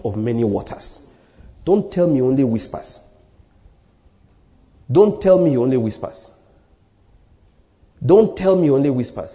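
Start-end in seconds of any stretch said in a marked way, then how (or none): 1.6-9.3 each word of "Don't tell me he only whispers" set down firmly; emphatic repetition. Real, not acted. Preacher challenging people who say God only whispers, emphatic. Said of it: "I'm just trying to emphasize".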